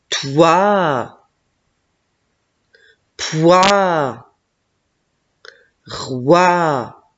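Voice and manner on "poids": normal talking voice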